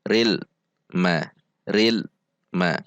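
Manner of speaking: "ril", full pause, then "ma"; separated. Shown as a demonstration. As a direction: break between "ril" and "ma". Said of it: "dipisah seperti". ("ril", "ma" dipisah)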